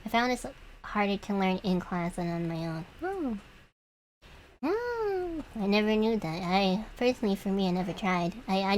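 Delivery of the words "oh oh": surprised oh. Real, not acted. Surprised reply to chat.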